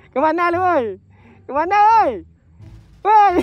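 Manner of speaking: very high, full-volume holler; yelling after them. Real, not acted. Prankster calls after the fleeing couple, yelling. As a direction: shout after someone walking away.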